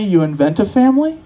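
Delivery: ordinary talk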